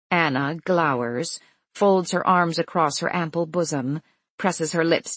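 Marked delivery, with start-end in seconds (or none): none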